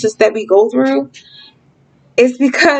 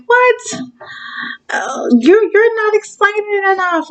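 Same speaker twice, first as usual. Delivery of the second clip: much higher, put-on complainer voice; mock objecting listener; 11 semitones higher than usual. Speaker imitates viewers who don't get it, high mock complaint. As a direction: squeak out a mock protest in a high voice. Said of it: "some of you are like".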